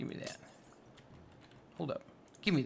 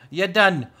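loud, higher burst of triumph; excited shout; 3 semitones higher, 9 dB louder than usual. Real, not acted. Finishing the build with a loud triumphant shout. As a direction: shout it out proudly.

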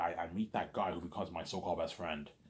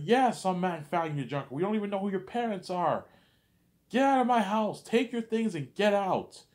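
high, loud screaming rage; father disowning the boy, screaming high and loud; furious dad; 13 semitones higher, 8 dB louder than usual. Retold or imitated. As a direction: scream it down at the son like a raging father.

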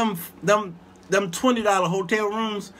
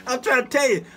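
usual speaking voice

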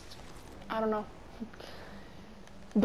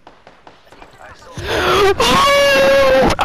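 real high, very loud shriek; shocked scream; 13 semitones higher, 13 dB louder than usual. Real, not acted. Streamer shrieks at a sudden enemy, very loud and high.

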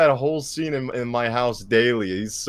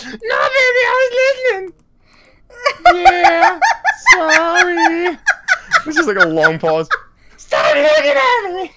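very high cartoon clown, yelling, half crying and laughing; squealing clown; 7 dB louder than usual. Clown caught snorting sweetener, squealing high and crying with laughter.

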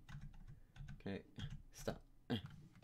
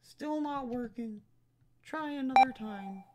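voicing the game character much higher, a touch louder; 14 dB louder than usual. Gamer voicing the game character at the terminal, high and squeaky.

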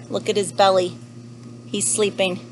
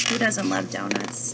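ordinary delivery

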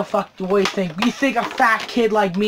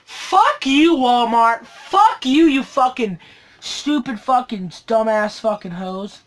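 pissed off; slower than usual. Real, not acted. Collector curses the store over a damaged package, pissed off. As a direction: curse them out, punching each insult. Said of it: "a lot pissed when I find".

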